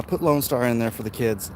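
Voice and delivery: normal talking voice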